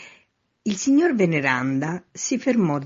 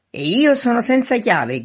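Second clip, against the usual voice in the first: high, loud, peeved cry; irritated shout; 9 semitones higher, 7 dB louder than usual. A narrator's reading. Man locked out at front door, shouting crossly. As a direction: shout it up, vexed. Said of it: "gridò il signor Veneranda stizzito".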